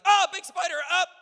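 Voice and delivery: sudden high loud yelp of fright; startled yelp. Retold or imitated. Homeowner spotting spider on stairs, high loud startled yelp. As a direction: shriek at the spider and topple.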